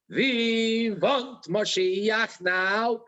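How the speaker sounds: slow imitation of a Russian accent, W said as V; drawn-out Russian-accented chant. The Rebbe leading children in a chant, slow Russian accent. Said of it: "in his, um, Russian accent".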